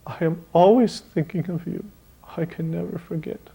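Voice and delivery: choked up. Retold or imitated. An old teacher moved by a farewell card, mildly choked-up. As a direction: let the voice catch as if holding back tears. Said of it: "he choked up and he said".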